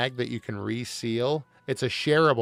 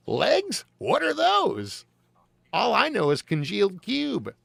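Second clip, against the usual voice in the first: much higher, slow clueless voice; oblivious consumer; 10 semitones higher, 5 dB louder than usual. Pretend. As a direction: play dumb like a happy cube eater. Mock dim consumer voice.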